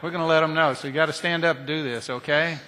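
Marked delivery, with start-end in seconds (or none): none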